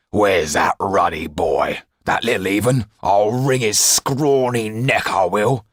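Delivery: slow, loud, rising fury; angry threat. Acted. Tull rages at the runaway boy, slow and loud. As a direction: spit out the threat as you run up. Panting jailer hunts the boy.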